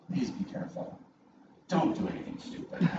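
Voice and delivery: soft breathy imitation of his wife; wife's hushed warning. Wife sending him off with a hushed warning. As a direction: hush it like a worried wife at the door.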